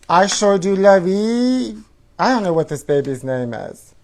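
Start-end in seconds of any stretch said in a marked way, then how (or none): 0.1-1.8 doll voice. Pretend. Doll voice reading name.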